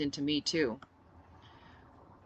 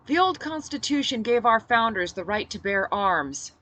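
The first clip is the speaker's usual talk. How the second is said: louder, higher rallying yell; 6 semitones higher, 6 dB louder than usual. Acted. She yells to the quieted fighters about their rights. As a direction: pitch it over the crowd. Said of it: "I yelled when they'd quieted".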